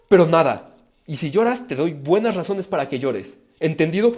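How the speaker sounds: regaño fuerte y cortante de un padre; padre enojado. Pretend. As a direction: corta al niño en seco, más fuerte. Padre amenaza al niño. (padre que amenaza a su hijo con voz fuerte)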